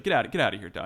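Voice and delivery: loud, urgent shooing; shooing a dog. Acted. Guard ordering a dog out, loudly shooing. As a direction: chase the dog off, raising your voice.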